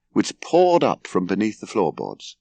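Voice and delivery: usual speaking voice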